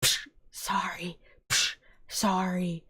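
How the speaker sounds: soft whispered, slow sorry; hushed mock-guilty sorry; whispered apology. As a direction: whisper sorry to the squashed spider. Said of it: "I was like".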